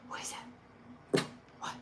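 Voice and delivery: gasp, then a breathless whisper. Real, not acted. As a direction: hush in sudden alarm. Dog reacts to noise.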